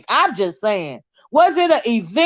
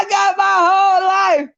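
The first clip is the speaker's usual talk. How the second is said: higher, laughing half-shout; 7 semitones higher than usual. Real, not acted. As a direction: blurt it out while cracking up. Cracking up at a comment.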